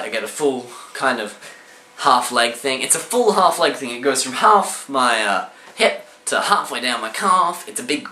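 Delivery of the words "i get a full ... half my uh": usual speaking voice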